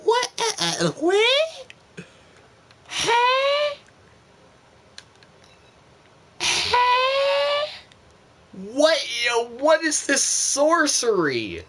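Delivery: high disbelieving squeals, slowing into what is this sorcery; stunned disbelief. Real, not acted. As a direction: yelp it out like you can't believe your eyes. Shocked at the rookie stats.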